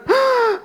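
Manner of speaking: sharp high gasp of a stunned crowd; one quick, high, amazed intake of breath; astonished gasp. Retold or imitated. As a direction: gasp in like a whole church in shock. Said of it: "And they went".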